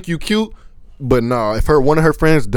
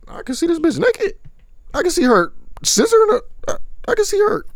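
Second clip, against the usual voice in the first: much higher, giddy excitement; excited; 13 semitones higher than usual. Real, not acted. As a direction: gush about it, voice jumping up. Excited about OnlyFans.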